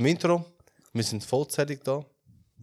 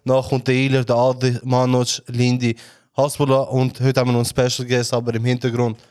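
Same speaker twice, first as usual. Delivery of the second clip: host announcement; 4 dB louder than usual. Acted. Host introduces guests.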